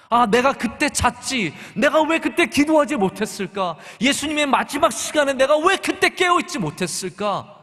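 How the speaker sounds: a hint of Peter sighing over his failure; Peter's regret. Voicing Peter's lifelong regret. (베드로의 후회를 살짝 탄식하듯)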